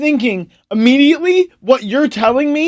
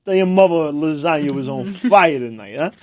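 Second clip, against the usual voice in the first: low, softer mock Italian Jersey voice; Italian Jersey accent; 6 semitones lower, 4 dB quieter than usual. Greeting a neighbour about dinner, mock Italian Jersey accent. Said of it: "some wicked Italian Jersey accent".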